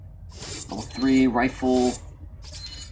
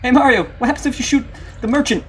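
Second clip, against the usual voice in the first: high, pitched-up sidekick voice; perky put-on voice, pitched way up; playful cartoon character; 11 semitones higher, 5 dB louder than usual. Acts Luigi asking Mario.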